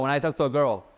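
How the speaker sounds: ordinary delivery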